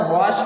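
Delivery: put-on old wrong "वॉश", said "waash". Shown as a demonstration. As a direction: open AA vowel in "वॉश". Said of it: "अभी तक क्या करते थे".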